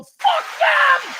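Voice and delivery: sudden furious high shout at the neighbors; angry yell. Retold or imitated. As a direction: bark it out like a fed-up neighbor. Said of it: "hear the dog barking and go".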